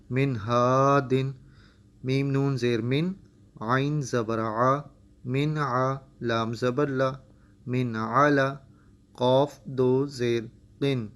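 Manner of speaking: slow syllable-by-syllable qaida chant, rising on each joined word; spelled-out Quran reading. Shown as a demonstration. Teacher spelling tanween words slowly for students. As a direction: spell each letter, then blend the syllables.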